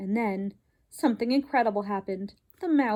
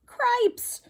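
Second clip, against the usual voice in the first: high mouse shout of alarm; 13 semitones higher than usual. Acted. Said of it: "shouted Furlough".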